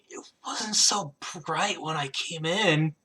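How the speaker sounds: drawn-out tipsy complaint; drunk squinting at light. Acted. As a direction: complain woozily, dazzled by daylight. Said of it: "he covers his eyes with his hand".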